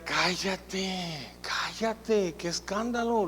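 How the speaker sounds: high, drawn-out mock hushing; mock scolding crowd. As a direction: shush a noisy beggar in mild outrage. Crowd scolds the shouting blind man.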